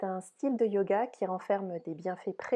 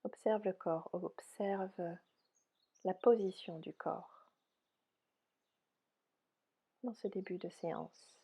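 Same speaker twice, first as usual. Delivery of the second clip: soft, unhurried yoga nidra guidance; softly spoken relaxation voice, spacious phrasing; guided meditation; 4 dB quieter than usual. Real, not acted. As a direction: guide them gently into rest, with long pauses.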